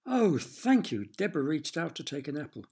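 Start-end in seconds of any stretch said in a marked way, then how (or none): 0.1-1.1 a hint of a pleased girl. A narrator's reading. Deborah accepting an apple.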